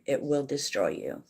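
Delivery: ordinary talk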